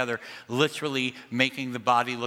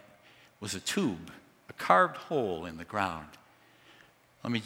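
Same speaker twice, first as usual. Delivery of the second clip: ordinary talk, nothing marked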